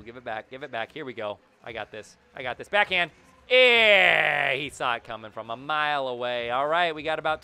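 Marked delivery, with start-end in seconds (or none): 2.7-4.5 excited shout. Real, not acted. Goal play in hockey game.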